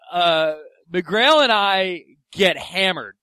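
ordinary talk, nothing marked

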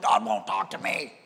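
low, raspy, growled whine; mock angry complaint. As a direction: imitate a believer yelling that he's being ignored. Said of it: "you keep yelling at him".

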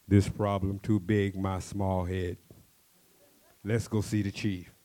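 slow, loud, simple speech; a hint of a slow giant; lightly voiced big warrior. Warrior speaks slowly.

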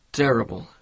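normal talking voice

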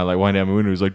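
usual speaking voice

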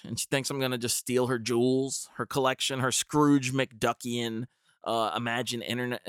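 ordinary delivery

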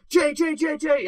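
loud, high repeated calls; urgent calling. Acted. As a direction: call out as if waking him from a nightmare. Acting out planned rush to wake sleeper.

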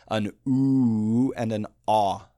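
long oo against short ah, drawn out clearly; vowel sound demo. Shown as a demonstration. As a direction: sing the two vowels apart for comparison. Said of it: "Think the difference between".

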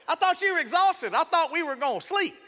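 high, loud mock outrage; indignant husband. Husband to supposedly exhausted wife, loud mock indignation. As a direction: protest in exaggerated disbelief.

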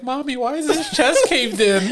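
put-on high, slow, laughing kid voice; mock child asking mommy; scared little kid. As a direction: play a startled child through a laugh. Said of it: "so I can scare some kid, like".

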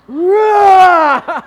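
loud high Hulk-like roar. Retold or imitated. Husband roaring like the Hulk at his wife on the phone. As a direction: let out a huge raging roar. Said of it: "I was turning green".

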